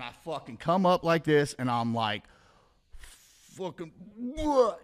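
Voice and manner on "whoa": high heaving retch noise; imitated loud heave. Man retelling his sickness to a friend, imitated heave. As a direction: imitate a dry heave, pitched way up. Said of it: "the loudest fucking heaves".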